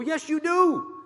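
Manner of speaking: high, loud rebuttal shouted to the room; preacher's shouted answer; emphatic shout. Real, not acted.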